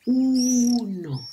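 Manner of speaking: long, loud, high U opening "uno"; stretched first vowel. Shown as a demonstration. Teacher showing children the first vowel, stretched "uno". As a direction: draw out the U of "uno". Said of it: "que comienza con U".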